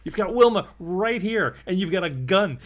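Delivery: can't believe he's missing what's in front of him; exasperated outburst. Real, not acted.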